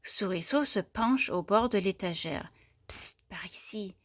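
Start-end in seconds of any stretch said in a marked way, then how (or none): 2.9-3.9 whispered psst to get attention; secretive whisper. A narrator's reading. Little mouse on a shelf calls a boy, furtive whisper. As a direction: whisper it furtively, hidden from the teacher.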